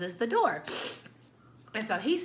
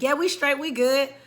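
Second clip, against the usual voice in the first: calling out louder through the door; loudly; 6 semitones higher, 5 dB louder than usual. Retold or imitated. Through the closed door to the friend, loud reassurance. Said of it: "like, loudly".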